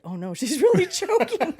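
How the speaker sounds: high, loud laughing realization; suddenly alarmed, half laughing; mock alarm. Friend realizes the narrator is really choking, high laughing alarm. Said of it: "then she's like".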